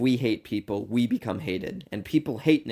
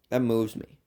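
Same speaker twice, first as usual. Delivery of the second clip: ordinary delivery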